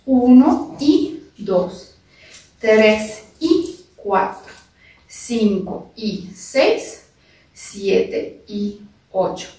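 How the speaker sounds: slow drawn-out counting, each number held; slow dance count. Shown as a demonstration. Dance teacher slowly counting the samba rhythm for students. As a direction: stretch the count so they can follow. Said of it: "Otra vez ese movimiento".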